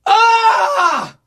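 high, loud drawn-out "ahh"; exclaimed high-pitched howl; loud high wail. Real, not acted. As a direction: cry out high and long in mock agony. Host reacts to sad text.

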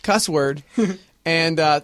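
ordinary talk, nothing marked

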